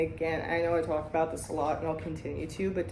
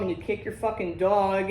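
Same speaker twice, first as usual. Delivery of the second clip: usual speaking voice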